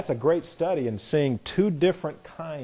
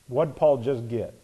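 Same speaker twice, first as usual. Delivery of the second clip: ordinary talk, nothing marked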